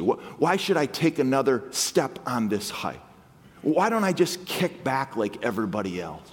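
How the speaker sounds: ordinary talk, nothing marked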